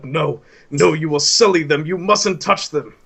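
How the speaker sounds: high, loud, desperate refusal; snatch them back like a crazed man; frantic possessive madman. Acted. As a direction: Vago refusing to let the Magus touch his leaves, frantic. Vago guarding leaves from Magus.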